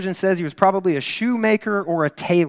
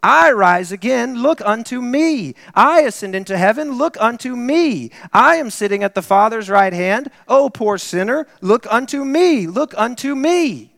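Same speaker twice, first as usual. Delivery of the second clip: Christ pleading; 5 semitones higher, 6 dB louder than usual. Retold or imitated. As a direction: plead with the poor sinner, drawing it out. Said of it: "It is Christ that speaks and says".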